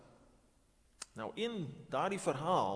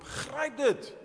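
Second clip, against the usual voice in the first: loud, high urgent call; uitroep na drenkeling; 9 semitones higher, 6 dB louder than usual. Acted. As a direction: call it out to someone drowning. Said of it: "en roep". (dringende, harde uitroep)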